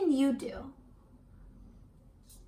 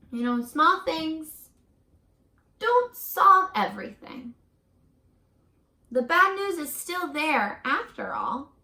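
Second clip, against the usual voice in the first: slow, weighty reading with long pauses, louder towards the close; storybook reading; 5 dB louder, slower than usual. A narrator's reading. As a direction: build to the last words, then let them fall away. Reading picture book aloud.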